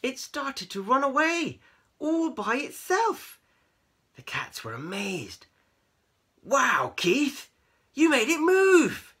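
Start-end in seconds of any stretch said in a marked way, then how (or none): 0.0-3.4 high, loud, astonished storytelling; amazed narration. A narrator's reading. Storyteller revealing the wand moving alone, amazed high voice. 6.4-9.1 high, loud awed cats; gasping amazement. A narrator's reading. Cats seeing the wand move, amazed exclamation. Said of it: "they gasped".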